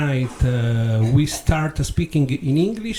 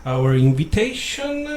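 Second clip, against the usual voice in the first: ordinary talk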